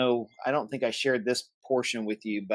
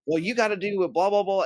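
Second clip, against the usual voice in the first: mocking the salesman; 8 semitones higher, faster than usual.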